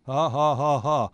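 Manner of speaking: loud, deliberate mock laugh; villain's laugh. Witch scorning her rebellious children, staged villain laugh. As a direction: laugh in separate, scornful beats.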